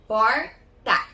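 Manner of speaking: "Porta" split into por, pause, ta; syllable by syllable. Shown as a demonstration. Teacher showing pupils the syllables, split word. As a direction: say "Porta" in two separate, firm beats. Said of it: "quantas vezes nós vamos abrir".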